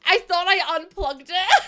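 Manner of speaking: high, loud words through laughter; laughing. Real, not acted. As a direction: exclaim it slowly while cracking up. Device plays, she bursts out laughing.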